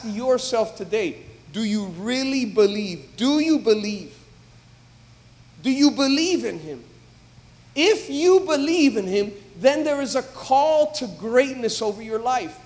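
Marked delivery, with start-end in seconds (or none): none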